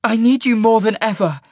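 loud, high urgent appeal. A narrator's reading. Sasha telling Cole she needs him, urgently. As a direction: insist desperately, with urgency. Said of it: "Sasha said urgently".

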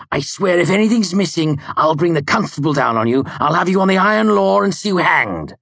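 high, loud furious threatening; venomous threat. A narrator's reading. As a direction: threaten with rising outrage. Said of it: "he spat venomously".

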